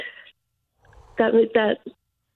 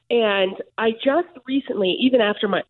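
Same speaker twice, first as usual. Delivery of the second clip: normal talking voice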